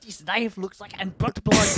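hammed-up American doing German; German accent. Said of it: "I can't do a German accent".

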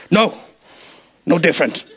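lightly voiced wise alien master; Yoda. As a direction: lightly play the patient little master, pausing between phrases. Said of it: "and Yoda says".

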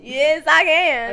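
loud, drawn-out Australian accent. Shown as a demonstration. Insisting to a friend, loud Australian accent. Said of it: "I can get into Australian".

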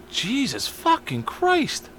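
high, slow, soft disbelief; stunned slow curse. Retold or imitated. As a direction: let it sink in. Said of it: "I'm like".

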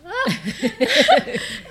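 high, loud laugh near tears; real laugh on the edge of crying; tearful laugh. Real, not acted. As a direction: laugh through rising tears. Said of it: "I'm gonna start crying".

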